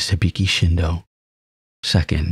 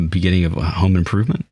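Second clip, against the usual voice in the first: ordinary delivery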